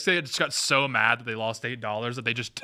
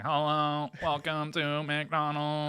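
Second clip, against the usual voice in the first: slow, stretched robotic greeting; monotone it like a drive-thru machine; mock robot drive-thru greeting; robot cashier; 5 semitones higher, much slower than usual.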